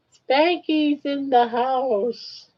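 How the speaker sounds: drawn-out, slow mock-announcer; playful slow announcement; slow announcer drawl. Real, not acted. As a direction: stretch it out like a ring announcer. Announcing the other pet.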